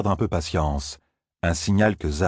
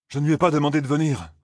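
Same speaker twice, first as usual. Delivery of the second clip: quick protest; 8 semitones higher, faster than usual. Acted. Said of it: "s'écria Perrine".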